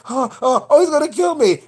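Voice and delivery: mock scared. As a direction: play the terrified victim. Acting out the scared granddaughter.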